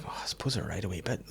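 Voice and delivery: whispered, unvoiced self-doubt; mock whisper. Comedian imitating their own inner doubt, whispered. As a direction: breathe it out like a private confession.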